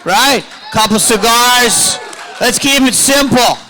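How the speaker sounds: loud, high comic hollering; hammed-up, high-pitched rallying shout; mock rant. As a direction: holler it out at the crowd.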